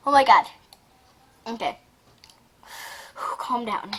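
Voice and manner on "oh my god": usual speaking voice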